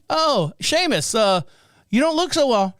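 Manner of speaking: high, hesitant acted clerk; doubtful clerk. Clerk eyes propped-up pensioner, high doubtful voice.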